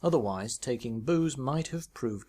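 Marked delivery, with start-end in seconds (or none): none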